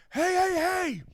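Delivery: high, loud shout to stop a car; worker shouting. Retold or imitated. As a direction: yell at the speeding driver. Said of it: "and a guy goes".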